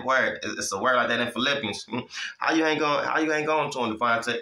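ordinary talk

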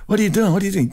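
high-pitched, agitated mock scolding; imitated abuse. Gun owners abusing the buyer, high-pitched mock outrage. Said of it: "just started abusing the hell out of me".

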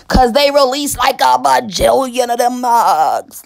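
high, loud comic hyperbole; over-the-top exclaiming; loud exaggerating. Real, not acted. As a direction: blow it up big, drawing out the last word.

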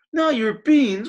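high, loud outraged cry; a loud shocked cry of protest. Acted. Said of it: "cried Scapito, outraged".

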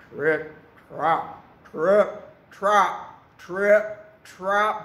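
loud, weighty, spaced-out trip-trap; heavy hoofsteps. A narrator's reading. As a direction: land each word like a big goat's hoof. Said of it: "He is very big and very heavy".

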